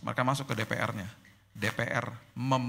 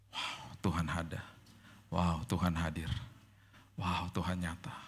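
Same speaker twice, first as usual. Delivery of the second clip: hushed wonder; 9 semitones lower, 7 dB quieter, slower than usual. Shown as a demonstration. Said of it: "Dan kita bilang gini".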